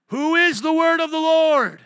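high, booming shout to the congregation; full-force pulpit yell; preacher's shouted challenge. Real, not acted. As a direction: roar the question, stretching the last word. Preacher demanding congregation answer.

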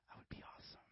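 breathy dreamy whisper; whispered daydream. Retold or imitated. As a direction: hush it like a private wish.

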